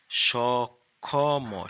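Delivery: drawn out very slowly and loudly, syllable by syllable; teacher sounding out সক্ষম very slowly and loudly; slow spelling demo. Shown as a demonstration. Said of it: "দন্তে স".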